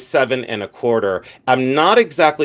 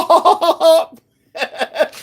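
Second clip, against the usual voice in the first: fake laugh; much slower than usual. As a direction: squeal out an over-the-top fake laugh. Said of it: "I'm gonna laugh sporadically".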